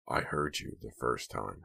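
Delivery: deadpan him back; dry. A narrator's reading. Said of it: "Hank said dryly".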